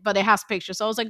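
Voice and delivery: ordinary talk, nothing marked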